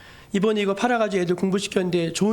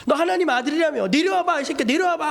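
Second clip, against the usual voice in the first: high-pitched taunting from a crowd; acted heckling, pitched up; mocking jeer; 6 semitones higher than usual. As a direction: dare him with open scorn. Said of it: "사람들이 다 조롱해요".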